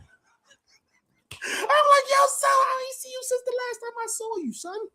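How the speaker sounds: very high laughing voice; high giggling hello to a homie just home; laughing greeting. Retold or imitated. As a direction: squeal the greeting through laughter. Greeting an old friend, laughing.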